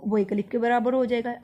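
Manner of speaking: usual speaking voice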